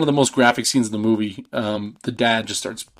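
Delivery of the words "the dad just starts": ordinary delivery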